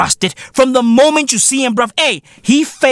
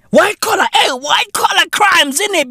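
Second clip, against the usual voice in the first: emphatic shout; 7 semitones higher than usual. Shown as a demonstration.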